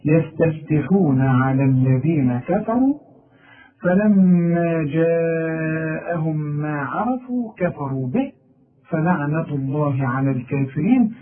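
measured, chanted recitation; Quranic recitation. Shown as a demonstration. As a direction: recite the verse in tajwid. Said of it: "هنقرا بقى السطر الأخير".

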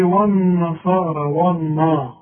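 والنا with a heavy, thick و; والنا with و wrongly made heavy; imitating the student's mistake. Teacher mocking the student's heavy و. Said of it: "إنما أنت هتقول لي".

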